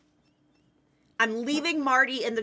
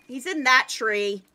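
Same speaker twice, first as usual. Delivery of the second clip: usual speaking voice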